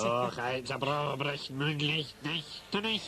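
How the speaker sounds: lightly voiced fake Scottish burr; bad Scottish accent, lightly done; mock Scottish accent. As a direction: overdo the Scottish sounds for a joke. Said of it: "if only we could place the accent".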